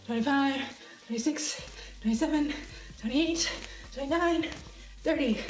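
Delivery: breathy exercise count, one number per jump; counting through exercise. Real, not acted. Streamer counting own jumping jacks, puffing. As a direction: count out each jumping jack in short puffs.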